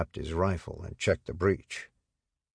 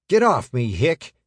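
sharp, loud and high outburst; angry; 8 semitones higher, 8 dB louder than usual. A narrator's reading. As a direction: snap it angrily at him. Shoved soldier snaps at comrade.

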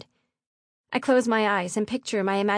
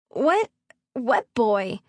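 flustered daughter; 2 semitones higher, 4 dB louder, much slower than usual. A narrator's reading. Daughter denying a boy to her father, flustered. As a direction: feign ignorance, caught off guard.

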